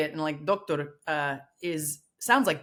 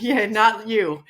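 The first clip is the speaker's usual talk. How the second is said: teasing; 2 semitones higher, 7 dB louder, much slower than usual. Retold or imitated. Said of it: "You're like".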